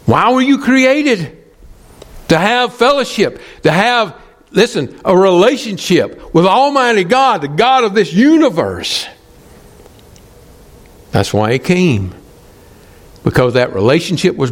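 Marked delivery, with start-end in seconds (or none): 0.0-9.1 impassioned preaching. Acted. Preacher telling the congregation why they were created, impassioned. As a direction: preach it high, stretching the key words.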